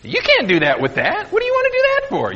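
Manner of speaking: fast, high, sneering imitation; mock the rude man with a squeaky sneer; hammed-up condescending clerk voice; snotty mock voice. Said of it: "just being very rude and very snotty".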